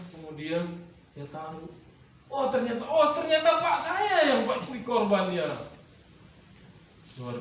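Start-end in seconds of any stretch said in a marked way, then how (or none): 2.3-5.5 dawning shock. Retold or imitated. Isaac realizes he is the sacrifice.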